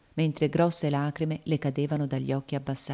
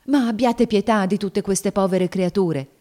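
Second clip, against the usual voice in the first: higher, louder appeal for pity; urgent plea; 5 semitones higher, 6 dB louder than usual. Acted. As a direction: plead on behalf of others. Woman pleads with Tom for pity.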